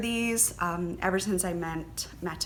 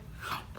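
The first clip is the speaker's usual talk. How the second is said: excited gasp; 11 dB quieter than usual. Real, not acted. Gasping at Wonder Woman yarn.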